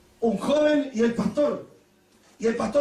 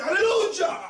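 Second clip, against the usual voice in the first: a sudden high joyful cry; shouted hallelujah; 9 semitones higher, much slower than usual. Retold or imitated. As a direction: burst out praising. Pastor shouting while driving. (grito de aleluya imitado)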